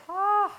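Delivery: loud, high "ah" of stinging pain; pained cry. Real, not acted. Cook's eyes burning from onions, pained cry. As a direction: let out a sudden high yelp at the sting.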